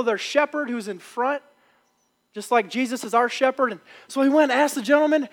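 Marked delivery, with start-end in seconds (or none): none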